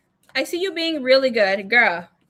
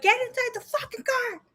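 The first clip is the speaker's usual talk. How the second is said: high, strained mock yell, held back in volume; retold panicked order, pitched way up; 13 semitones higher, 5 dB quieter than usual. Ordering her friend into the car at the knife, panicked.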